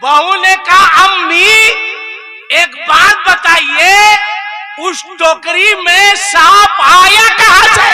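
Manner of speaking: high, loud demanding questions; heated confrontation. Acted. Mother-in-law and bride shouting at each other. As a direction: demand answers at full voice.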